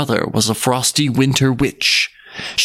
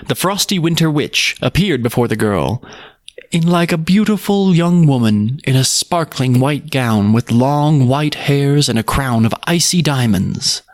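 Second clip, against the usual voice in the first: plain reading voice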